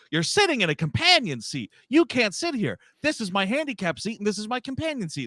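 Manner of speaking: high, huffy lady laying down the rules; entitled lady. Retold or imitated. Lady telling the man he can't sit there, high and huffy. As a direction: talk down to him about the seats. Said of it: "And she's just like".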